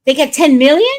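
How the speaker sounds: can't believe the figure; incredulous. Real, not acted. Hearing about a payout, loud rising disbelief.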